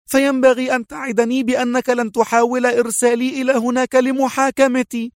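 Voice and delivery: high woman's voice, sharp and insistent; high sharp defiance; sharp exclaim. Acted. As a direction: fire back high, bargaining hard. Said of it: "هتفت في حدة".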